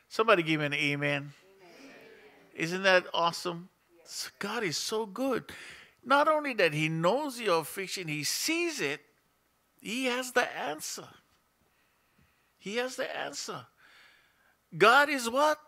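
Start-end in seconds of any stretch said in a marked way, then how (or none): none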